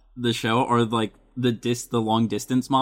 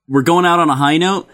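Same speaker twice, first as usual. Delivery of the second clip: host sign-off; 5 dB louder, faster than usual. Acted. Host sign-off before break.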